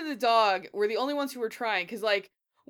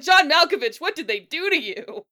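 loud, high-pitched exclamation of disbelief; mock dismay; 7 semitones higher, 10 dB louder than usual. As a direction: cry out in hammed-up bewilderment. Acting out dismayed reaction to the actor.

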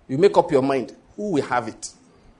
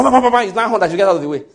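imitated horn; 4 semitones higher, 6 dB louder, faster than usual. Imitating honking car behind.

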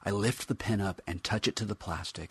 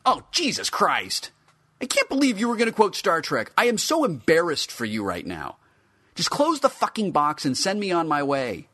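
higher, louder disgusted outburst; embarrassed scoffing; 10 semitones higher, 7 dB louder than usual. A narrator's reading. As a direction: cringe loudly at the quote. Figure mocks Star Trek quote.

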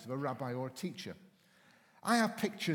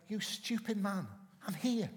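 higher, lightly voiced exasperated dog; dog's exasperated voice; 5 semitones higher than usual. Dog telling its owner he's right there, mildly exasperated higher voice. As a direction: let the dog scold him, pitched up. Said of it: "as if to say".

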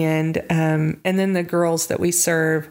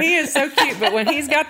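gushing; 8 semitones higher than usual. Real, not acted. Gushing about her husband.

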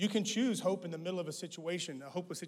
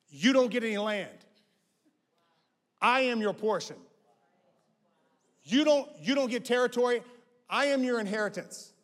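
loud, higher proclamation as God to the Levites; God voiced booming; 6 semitones higher, 10 dB louder than usual. God telling the Levites He is their portion, booming. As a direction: thunder it out as God. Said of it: "He goes".

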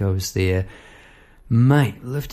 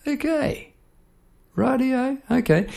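much higher, drawn-out doubtful okay; mum's doubtful okay; slower than usual. Retold or imitated. Mum hearing her son took a pet shop job, dubious okay. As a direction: imitate mum forcing herself to sound fine.

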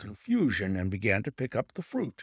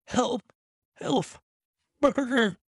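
high, urgent cries in short bursts; storyteller's contained scream for help; screamed cry for help; 12 semitones higher, 3 dB louder, slower than usual. A narrator's reading. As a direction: cry out as if being attacked. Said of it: "a sudden scream".

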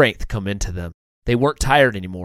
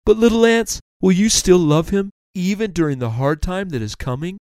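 higher, emphatic turtle teacher; kindly turtle, earnest; 8 semitones higher than usual. Acted. Turtle explains perseverance to little ants, earnest. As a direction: earnestly urge the little ones on.

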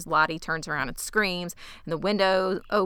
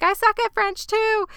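very high, louder whine; mock protest; 5 dB louder than usual. Jackie is yelled at to translate French, high mock protest. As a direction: imitate a flustered girl protesting shrilly.

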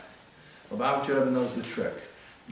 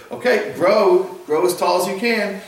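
loud, high exhortation; urging it on; 8 semitones higher, 9 dB louder than usual. Acted. Parents to the newly planted cedar, loud and urging. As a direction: cheer it on to grow, voice lifted.

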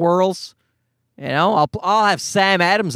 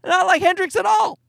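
harsh inner critic; 11 semitones higher than usual. Retold or imitated. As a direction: sneer at your own playing like a disgusted critic. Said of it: "and you're like".

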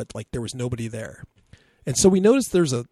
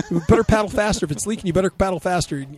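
higher, louder breezy urging; buddy's voice; 6 semitones higher, 3 dB louder, faster than usual. Retold or imitated. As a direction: Pete hurries the kid, unbothered. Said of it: "my buddy Pete".

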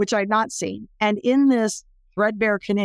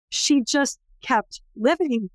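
slow, higher, weighing each word; slow emphatic; 5 semitones higher, slower than usual. Real, not acted. Speaker stresses that the survivor endured, slow and emphatic. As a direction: let "she just kept living" land slowly.

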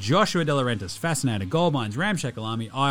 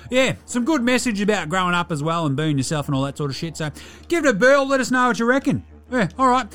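a cheeky Aussie larrikin with a higher voice; bogan character Delhi; 4 semitones higher than usual. Acted. Delhi does his pop culture review, cheeky larrikin voice. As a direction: play him like a mouthy mate down the pub.

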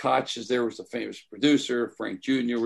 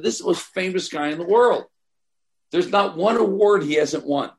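indignant exclaim; 6 semitones higher, 5 dB louder than usual. Retold or imitated. Retold outrage in the restroom.

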